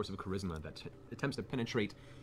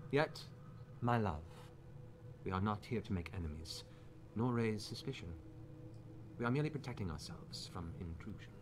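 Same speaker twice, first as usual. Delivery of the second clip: measured, soothing noblewoman's voice; Lady Briarwood; 2 semitones higher than usual. Acted. Lady calming her husband before guests, composed noblewoman. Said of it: "Delilah, Lady Briarwood".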